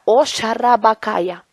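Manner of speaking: slow, drawn-out tongues; speaking in tongues. Real, not acted. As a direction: let the tongues flow slowly and reverently. Tongues within Jesus' words. (hablando en lenguas, lento)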